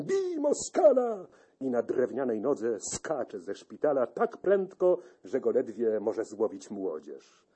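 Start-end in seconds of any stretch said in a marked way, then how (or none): 0.0-1.3 sudden high battle cry, slow and drawn out; dream-shout of a crippled veteran; shouting in sleep. A narrator's reading. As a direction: bellow it like an old soldier dreaming of war. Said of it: "krzyczy przez sen".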